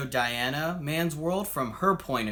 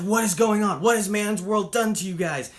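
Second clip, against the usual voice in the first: louder, higher, shocked and distressed; alarmed outcry; 5 semitones higher, 5 dB louder than usual. Retold or imitated. Diana horrified by the dying women, alarmed outcry. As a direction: cry out in horror at the sick women. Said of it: "she immediately freaks out".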